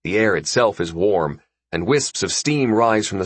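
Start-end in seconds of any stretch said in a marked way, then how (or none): none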